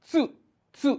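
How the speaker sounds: চু as a short "tsu", t and s together; tsu pronunciation demo. Shown as a demonstration. Teacher shows a difficult sound, short isolated tsu. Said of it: "এটা খুবই কঠিন প্রথমে শুনতে".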